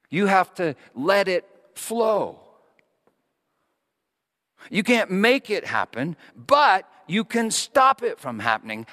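a pastor hammering home forgiveness; forceful preaching. Acted. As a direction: preach it out to the congregation.

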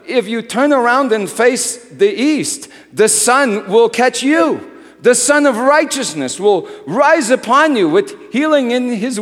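loud, high preaching of a parable; full-force preaching. Acted. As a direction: proclaim the story to the whole room.

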